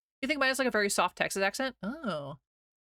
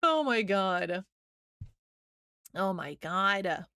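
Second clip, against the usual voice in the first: exasperated; much slower than usual. Real, not acted. Exasperated at chat.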